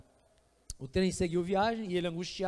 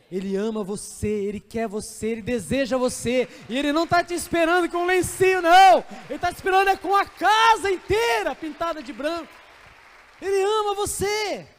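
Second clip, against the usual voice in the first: building from plain to a much louder, higher climax; preaching at full force; 7 semitones higher, 9 dB louder than usual. Acted. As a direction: preach it, swelling to the top. (pregação inflamada, crescendo até o auge)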